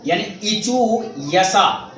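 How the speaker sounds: यानी इचू यसा drawn out slowly; teacher reads इचू यसा slowly, split into parts; slow syllable reading. Shown as a demonstration. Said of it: "इचू यसा नाम पढ़ना भी इसी तरह आपको है".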